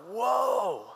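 drawn-out, falling whoa; acted-out taken aback; stunned reaction. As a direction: let it out slowly, stunned. Acted Jonathan stunned by father's outburst.

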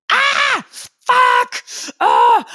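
high, loud frustrated outburst; reenacted scream. Trainer reliving frustrated moments at the mirror, reenacted scream. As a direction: imitate screaming at your own reflection. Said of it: "moments where I just screamed".

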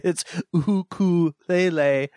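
ukulele slowly sounded out as oo-koo-LEH-leh; mock-pedantic, drawn-out Hawaiian ukulele; pretentious correction. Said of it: "start correcting everybody".

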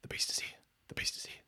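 soft, voiceless repeated whisper; whispering to himself. Acted. Rocking man whispers to himself about beast, voiceless whisper. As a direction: whisper it obsessively under the breath. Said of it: "whispering to himself now".

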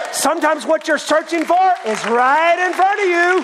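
high, loud preacher's shout; full-force preaching. Real, not acted. Preacher urges friends that it's right in front, shouting. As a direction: cry it out to the congregation, stretching key words.

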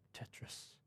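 hushed and unvoiced, to himself; muttering to himself. Real, not acted. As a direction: mutter it under your breath, puzzled. Puzzling alone over the image.

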